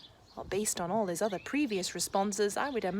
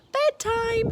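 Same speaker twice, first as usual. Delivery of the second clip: high loud call upstairs; 12 semitones higher, 10 dB louder than usual. Acted. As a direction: call bedtime from downstairs. Calling Ned from below.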